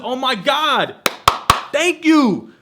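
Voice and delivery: high, loud, overjoyed thank you; grateful relief. Retold or imitated. Vlogger retelling the dental bill, high loud grateful relief. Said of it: "I said".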